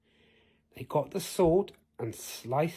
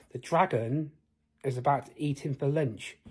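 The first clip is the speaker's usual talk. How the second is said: ordinary delivery, nothing marked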